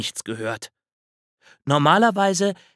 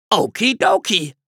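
much higher, louder cheerful chirp; chirpy okay; 9 semitones higher, 5 dB louder than usual. A narrator's reading. Squirrel agrees to the bear's plan, chirpy. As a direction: chirp it brightly.

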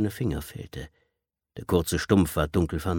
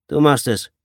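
loud, higher command; harsh order; 7 semitones higher, 8 dB louder than usual. Acted. Gang leader orders the smallest kid, loud command. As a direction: hand him the knife and order it.

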